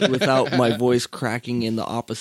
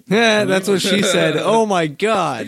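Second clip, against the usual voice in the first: loud joke; 6 semitones higher, 6 dB louder, slower than usual. Acted. Joking after deeper voice request.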